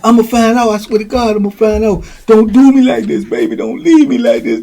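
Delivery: high-pitched imitated desperate outburst; acted frantic jealous pleading; going off. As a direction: go off on a lover while begging. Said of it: "he going off on him".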